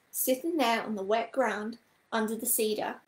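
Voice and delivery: ordinary delivery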